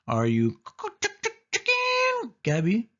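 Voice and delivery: very high, squawking chicken chant; chicken clucking taunt. A narrator's reading. Sari mocks her scared cousin, clucking like a hen. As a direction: cluck it like a hen to mock him. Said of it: "she repeated".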